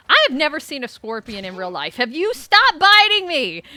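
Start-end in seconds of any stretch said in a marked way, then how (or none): 2.3-3.6 high, loud and slow complaint; stretched-out annoyed yelp; loud protest. Real, not acted. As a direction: yell at the biting scorpion. Scorpion biting character.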